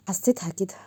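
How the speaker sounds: plain reading voice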